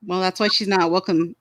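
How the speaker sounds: ordinary talk, nothing marked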